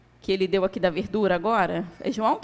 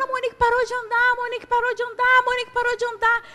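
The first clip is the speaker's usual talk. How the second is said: alarmed crowd cry; 14 semitones higher, 6 dB louder, faster than usual. Retold or imitated. Neighbours rushing in, alarmed.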